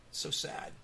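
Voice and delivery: soft whispered sorrow; whispered sadness. Real, not acted. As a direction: breathe it out sadly. Sad story of missing girl.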